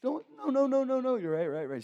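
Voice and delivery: put-on high, loud pleading kid voice; mock crying child. Kid pleads with dad before a spanking, mock crying. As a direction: imitate a panicked kid about to be spanked. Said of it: "immediately I'd just start crying".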